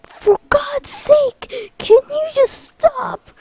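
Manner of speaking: high, slow, drawn-out whine; hammed-up wail of frustration; exasperated wail. Lexaeus beaten by Sora's summons, wailing exasperation.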